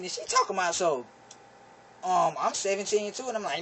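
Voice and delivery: usual speaking voice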